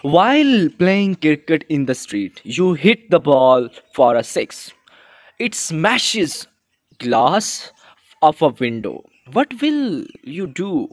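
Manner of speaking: reading the situation word by word; slow read-aloud. Acted. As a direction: lay out the scene slowly for the class. Reads the situation slowly. (धीरे धीरे पढ़कर सुनाना)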